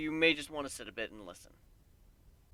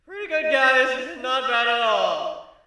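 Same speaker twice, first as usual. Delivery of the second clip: high, loud, slow stage praise; host praise; 10 semitones higher, 11 dB louder, slower than usual. A narrator's reading. Host on stage praising the singers, slow and loud. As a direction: praise the crowd like an emcee.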